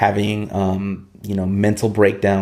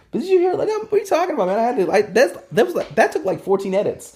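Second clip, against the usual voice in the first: much higher, exasperated mock comeback; a hint of offended protest to a critic; indignant retort; 11 semitones higher than usual. Poet retelling his reply to a critic, higher exasperated protest. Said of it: "I'm like".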